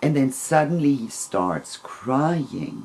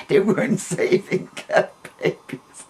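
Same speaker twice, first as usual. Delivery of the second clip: said through laughter; amused, laughing at her own naivety; 7 semitones higher than usual. Real, not acted. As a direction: laugh the words out.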